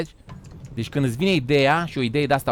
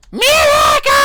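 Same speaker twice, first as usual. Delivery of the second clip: sudden high shout of the name; 8 dB louder, much slower than usual. Real, not acted. Streamer shouting a friend's name across the room, loud high shout.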